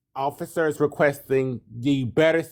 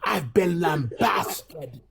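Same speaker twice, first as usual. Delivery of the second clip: posh accent; 7 semitones higher, much slower than usual. Shown as a demonstration. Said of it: "supposed to say it in, like, that accent".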